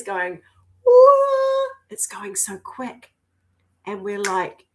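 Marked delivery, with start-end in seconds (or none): 0.8-1.7 piercing high "woo" of excitement; very high, loud woo; high excited whoop. Real, not acted. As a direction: whoop like on a rollercoaster. Mimicking energy rushing.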